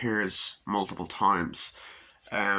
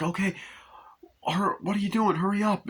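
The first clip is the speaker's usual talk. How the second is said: high-pitched impatient hurrying; mock urgency; 11 semitones higher than usual. Traveller rushing at the airport, high mock-urgent voice. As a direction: act out a flustered traveller chivvying staff. Retold hurried airport exchange.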